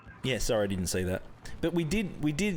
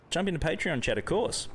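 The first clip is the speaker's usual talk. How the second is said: ordinary talk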